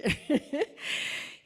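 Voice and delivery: loud, high laugh; burst of laughter. Real, not acted. Host giving up on the awards list, laughing out loud. Self-deprecating aside about awards.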